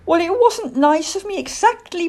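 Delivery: high, loud little-owl voice; earnest owlet owning up; young owl Plop, candid. Acted. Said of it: "Plop said truthfully".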